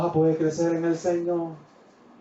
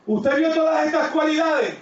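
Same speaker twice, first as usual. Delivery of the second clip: raised, high-pitched preaching voice; 9 semitones higher than usual. Real, not acted. Pastor rousing the congregation, raised preaching voice. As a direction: preach it out with fervor to the church.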